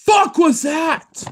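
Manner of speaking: very high, loud, drawn-out shock; real startled shout. Real, not acted. Startled by something unexplained nearby.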